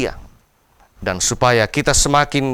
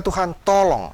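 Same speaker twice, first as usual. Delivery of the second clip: "Tuhan, tolong" lifted higher and reaching out; open cry for help; 6 semitones higher than usual. Shown as a demonstration. Preacher showing the right way to call on God, open plea. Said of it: "Tapi berkata".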